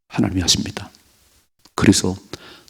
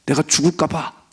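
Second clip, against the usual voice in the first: fearful fretting; 5 semitones higher than usual. Retold or imitated. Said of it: "여전히 내 생각으로".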